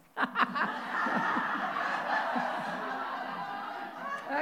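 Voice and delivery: drawn-out airy chuckle; long breathy laugh. Real, not acted. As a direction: laugh at your own joke. Preacher laughing at own joke.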